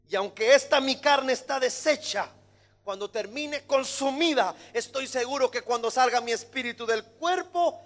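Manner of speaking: high, loud declamation; fervent preaching. Acted. Preacher as Job sure of seeing God, fervent preaching. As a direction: proclaim it, slowing and swelling on the last words. Preacher voices Job's faith.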